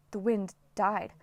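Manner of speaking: ordinary delivery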